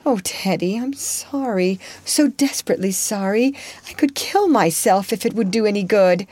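high, pleading apology; remorseful crying out. Acted. Joe pleading remorsefully with heartbroken Laurie. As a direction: beg him to stop hurting. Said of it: "cried Joe, inelegantly but remorsefully".

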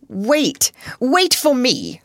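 high, loud call to hold on; urgent cry. A narrator's reading. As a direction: call out urgently, stretching the last words. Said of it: "cried a voice".

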